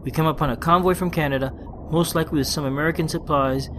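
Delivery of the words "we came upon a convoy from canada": ordinary talk, nothing marked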